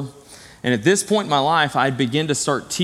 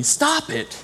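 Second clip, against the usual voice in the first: sudden high-pitched mock yell; high, held-back yell of someone snapping; retold yell; 13 semitones higher than usual. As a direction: imitate an angry outburst, pitched way up, not full volume. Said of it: "he yelled at me".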